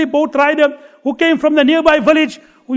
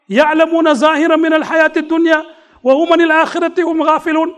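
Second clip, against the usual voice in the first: fast recited Arabic verse; rapid Quranic recitation; 4 semitones higher, much faster than usual. Acted.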